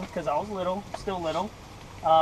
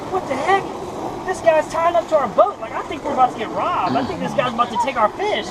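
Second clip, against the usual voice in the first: high, panicky mock alarm; acted freak-out; 5 semitones higher, 4 dB louder than usual. Boy fearing a stranger will rob them, high mock panic. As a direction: imitate a kid working himself into a panic. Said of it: "I'm freaking out".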